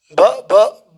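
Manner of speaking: acted stammer on one syllable; halting, demonstrated stutter. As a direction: stumble on the first sound, unable to finish. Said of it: "she could stammer".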